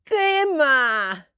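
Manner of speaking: a hint of a cross child's outcry; complaining little Moncho. Moncho at his first sip of milk, crying out crossly. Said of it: "arrugaba la nariz y decía".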